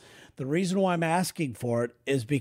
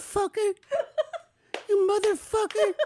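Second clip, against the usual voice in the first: put-on high child voice swearing; a lost kid suddenly cussing out; little kid voice cursing. Kid voice prank curse at caller.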